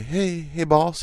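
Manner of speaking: imitating the new guy at work; coworker's voice. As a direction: put on his easygoing voice. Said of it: "This is his voice".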